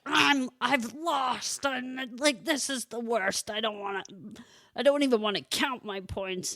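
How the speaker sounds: imitating a sulky kid. Mother voicing her upset son.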